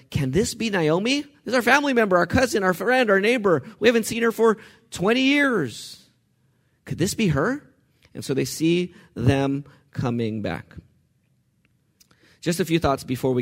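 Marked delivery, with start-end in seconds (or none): 0.1-7.7 higher, eager village women; excited exclaim. Acted. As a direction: gasp it out like neighbours spotting an old friend. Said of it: "the local women exclaimed".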